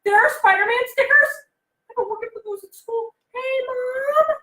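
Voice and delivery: put-on a child wailing in the store over the stickers; kid's excited outburst. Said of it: "he's like".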